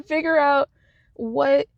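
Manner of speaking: usual speaking voice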